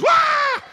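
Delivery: very high, loud comic shriek; startled scream. Retold or imitated. Mother surprised by man at back door, imitated startled scream. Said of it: "throws the dog food in the air and goes".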